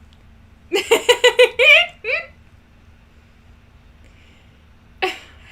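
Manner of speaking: very high, loud cackling laugh; loud burst of laughter. Real, not acted. Failing to get the voice down, helpless high laughter. Cracks up at own voice.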